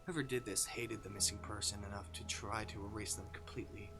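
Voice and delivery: ordinary talk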